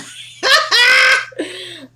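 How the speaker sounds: high, loud burst of laughter. Real, not acted. Woman realizing she sounds like her mother, laughing loudly.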